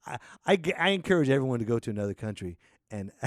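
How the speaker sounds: normal talking voice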